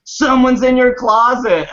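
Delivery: loud, high mock scream of alarm; high-pitched retold shriek. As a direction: imitate grandma crying out, drawn-out and high. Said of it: "she screamed".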